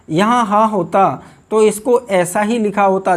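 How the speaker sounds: usual speaking voice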